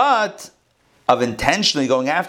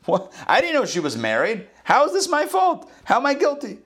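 indignant protest; 12 semitones higher than usual. Acted. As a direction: pitch climbing high in disbelief. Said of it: "This is Avimelech justifying himself to God".

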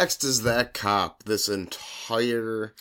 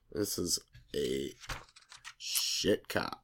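muttered disgust; 8 semitones lower, 11 dB quieter, slower than usual. Real, not acted. Disgusted aside about cop.

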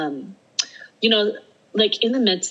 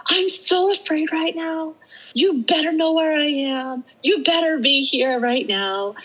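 shouting at God; 11 semitones higher than usual. Retold or imitated. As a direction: demand that God be there. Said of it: "shouting at him".

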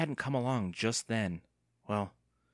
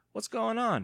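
higher-pitched call across a yard; 12 semitones higher, 4 dB louder than usual. A narrator's reading. Evan calls to Andy under the tree, calling out. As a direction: call over to a friend. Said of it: "he called".

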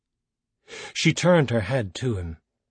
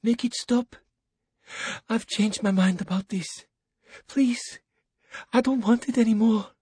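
whimper, pleading to stop; cowering plea; 11 semitones higher than usual. Acted. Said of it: "she whimpered".

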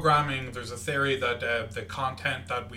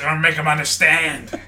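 loud; 2 semitones higher, 10 dB louder than usual. Pretend. Mock Chandler insisting, louder.

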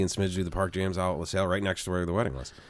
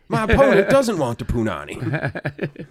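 put-on Jamaican accent; 6 semitones higher, 6 dB louder, slower than usual. Seagal in mock debate.

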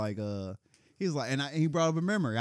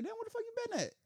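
much higher, softer surprised question; retold surprise; 7 dB quieter than usual. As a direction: squeak it out in shock. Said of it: "I'm like".

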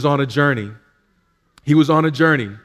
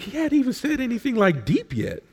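high, soft, incredulous bystander; mock a baffled neighbour in the pew; imitating a confused churchgoer whispering to a friend; puzzled onlooker; 9 semitones higher, 5 dB quieter than usual. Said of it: "You're like".

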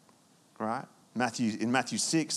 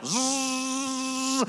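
buzzing leaf blower noise; 11 semitones higher than usual. Retold or imitated. Leaf blower sweeping the campsite.

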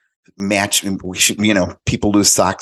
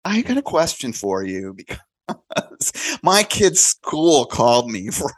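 mother calling, half laughing; amused friend; 5 semitones higher than usual. Retold or imitated. Friend phoning about school, amused, laughing. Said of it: "she's like".

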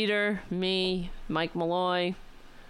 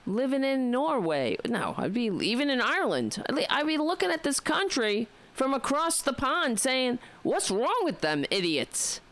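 put-on higher, lilting Irish accent; mock Irish accent; 7 semitones higher than usual. Imagining life as an Irish emigrant's unborn grandchild, mock Irish accent. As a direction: put on a light Irish lilt. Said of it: "I'd sound like an Irish person".

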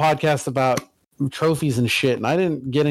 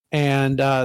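ordinary talk, nothing marked

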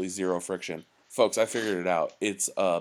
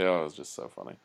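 ordinary talk

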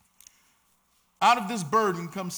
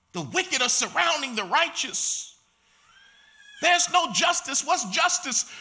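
raised, high preaching cadence; full-force preaching; 7 semitones higher, 5 dB louder than usual. Acted. Pastor preaching on justice to the church, raised preaching voice. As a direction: preach it out to the congregation.

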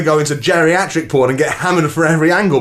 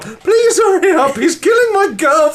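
frantic high shrieking 911 caller; panicked caller; 11 semitones higher than usual. Acted. Frantic caller screaming at the dispatcher. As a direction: scream for help like it's life or death. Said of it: "you be dispatcher, and I'll be herald".

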